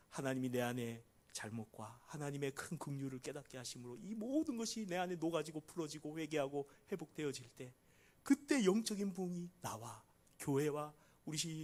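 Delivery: usual speaking voice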